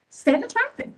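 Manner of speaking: high, loud, exasperated cry; emotional outburst. Real, not acted. Election official to county meeting, exasperated high outburst. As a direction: throw it up high in frustration. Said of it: "I'm a little emotional".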